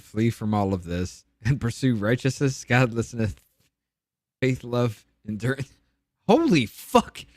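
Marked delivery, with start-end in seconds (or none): 6.3-7.2 higher, louder blurt of shock; stunned exclamation. Real, not acted. Struck by the Bible verse, blurting out in disbelief. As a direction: react like the verse hit you. Reaction after reading verse.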